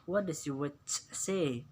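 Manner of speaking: slow, deliberate model question; slow model sentence. Shown as a demonstration. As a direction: read it slowly for the class. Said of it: "bisa Anda lihat". (ucapkan pelan untuk murid)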